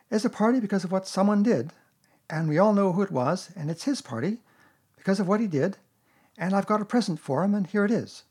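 put-on high, loud boy's announcement; Christopher Robin. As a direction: announce it as a proud little host. Christopher Robin gives a speech.

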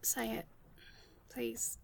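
hushed, quiet coaxing plea; barely breathed, begging gently; soft pleading. Acted. Coaxing partner to say love again, hushed plea.

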